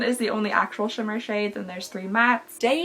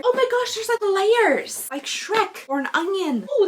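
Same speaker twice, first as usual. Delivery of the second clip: surprised; 8 semitones higher than usual. Real, not acted. Discovering layered packaging.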